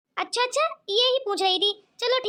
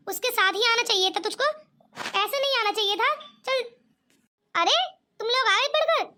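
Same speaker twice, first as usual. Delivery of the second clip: usual speaking voice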